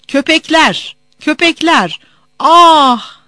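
high, loud outraged cries; screaming out. Acted. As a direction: cry out in pain and fury. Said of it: "diye bağırıyor, haykırıyordu". (acıyla haykırış)

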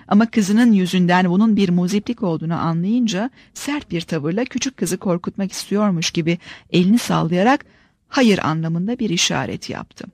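ordinary delivery, nothing marked

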